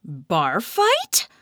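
high, loud shocked question; high note. A narrator's reading. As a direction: squeak it in disbelief. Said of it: "Kat's voice hit a high note".

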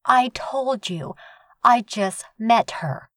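high, slow, clipped female snap; snapping. A narrator's reading. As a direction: spell it out slowly in irritation. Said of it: "she snapped".

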